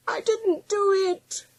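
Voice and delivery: high, slow whine; long, pleading high-pitched protest; whining dog. A narrator's reading. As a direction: whine innocence like a scolded dog. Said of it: "whined Willie".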